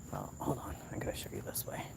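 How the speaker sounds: hushed whisper so no one hears. Real, not acted. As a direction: whisper it secretly. Sneaking to show lights.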